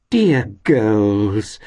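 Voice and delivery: high, effusive endearment; overflowing affection; gushing fondness. A narrator's reading. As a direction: coo it loudly in a landlady voice. Landlady gushes over the girls.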